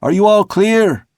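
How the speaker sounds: high, loud call up the rigging. Acted. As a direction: shout it up to the masthead. Mate calling aloft.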